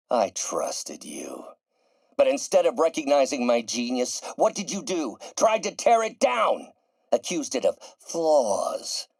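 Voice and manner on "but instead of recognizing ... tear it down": wounded pride boiling over; mounting outrage. Acted. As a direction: pitch climbing into furious indignation. Outraged at Parker's betrayal.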